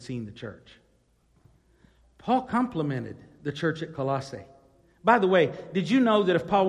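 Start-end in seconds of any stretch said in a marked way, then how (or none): none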